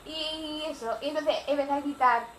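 ordinary delivery

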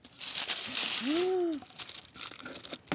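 long airy gasp; surprised intake of breath; delighted gasp. Real, not acted. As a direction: gasp as the gift appears. Opening another gift.

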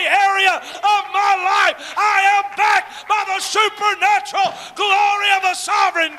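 loud, high, shouted preaching climax; full-force preaching. Acted. Preacher rallying the congregation, shouting at full force. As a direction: build the church up, shouting every line.

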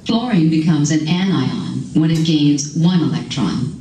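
narrated definition clip; recorded voiceover. Acted. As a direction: read like a textbook voiceover.

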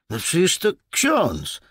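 put-on high, loud defensive young voice; apologetic protest. A narrator's reading. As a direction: excuse yourself to the boss, pitched right up. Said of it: "Chłopak przepraszająco rozłożył ręce". (przepraszająco, wysokim głosem chłopaka)

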